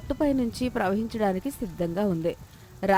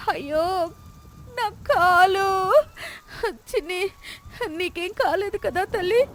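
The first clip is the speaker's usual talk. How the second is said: much higher, drawn-out pained cry for her leg; 10 semitones higher, slower than usual. Acted. Lakshmi hurt by the falling wall, crying in pain. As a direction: wail in pain, then worry for the child.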